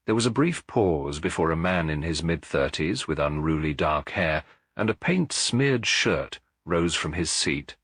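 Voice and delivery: ordinary delivery, nothing marked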